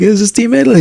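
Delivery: Italian guy. Retold or imitated. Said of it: "He's speaking Italian".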